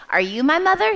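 high, loud storybook chick; baby bird's question. Acted. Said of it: "he sees a cow and he says".

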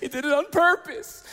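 put-on high-pitched whiny tattling kid; mock crying. Brother tattles to mom after being hit, mock crying. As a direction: imitate a tearful little brother telling on you. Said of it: "and he's crying".

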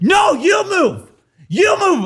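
high, slow shouted you move; mock angry reply. Imitating the angry man shouting back. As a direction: throw it back at him. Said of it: "He's like".

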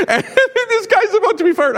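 laughing in disbelief; talking through a real laugh. Real, not acted. Laughing at absurd situation.

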